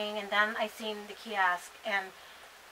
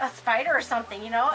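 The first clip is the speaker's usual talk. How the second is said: normal talking voice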